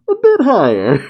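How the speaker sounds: mock AI guiding the aim; high robotic helper voice. Reviewer voices the aiming robot, high.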